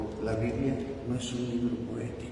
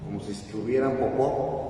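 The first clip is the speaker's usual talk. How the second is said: ordinary talk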